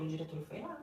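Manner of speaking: normal talking voice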